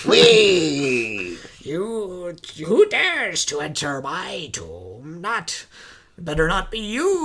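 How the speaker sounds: slow booming ghost voice; drawn-out spooky tomb ghost; unearthly voice. Retold or imitated. Ghost voice in movie recap.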